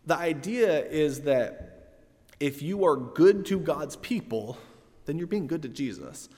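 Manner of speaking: ordinary delivery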